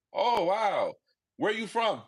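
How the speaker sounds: mock curious question. People asking about accent.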